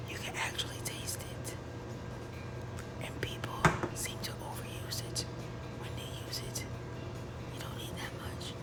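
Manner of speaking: soft unvoiced whisper with a sigh, voice nearly gone. Real, not acted. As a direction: whisper it, saving a sore throat. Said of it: "back to whispering 'cause my voice is going away".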